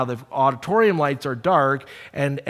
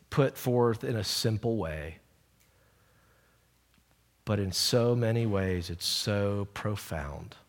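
closing prayer; 4 semitones lower, 7 dB quieter than usual. Real, not acted. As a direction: pray humbly for the congregation.

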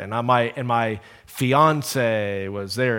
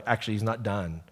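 ordinary talk, nothing marked

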